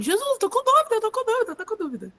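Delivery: high, fading mock plea; high-pitched repeated plea, trailing off; doubting disciples. Disciples voiced pleading their doubt.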